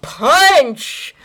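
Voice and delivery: loud excited answer; excited shout. Real, not acted.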